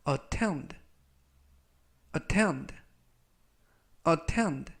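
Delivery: attend said three times, -tend clear and firm; model pronunciation. Shown as a demonstration. Teacher models attend for students, careful and clear. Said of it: "라고 발음하시면 되겠고요".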